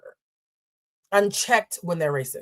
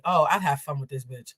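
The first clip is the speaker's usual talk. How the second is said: usual speaking voice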